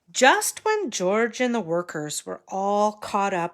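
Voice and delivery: ordinary delivery, nothing marked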